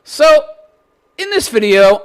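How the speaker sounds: ordinary talk, nothing marked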